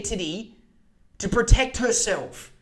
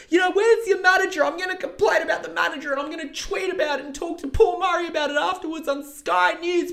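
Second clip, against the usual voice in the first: much higher mocking voice of an entitled complainer, fast; mock outraged customer; 12 semitones higher, 3 dB louder than usual. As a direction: berate the barista in a high put-on whine, yelling for the manager. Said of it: "she's gonna berate you for ten minutes saying".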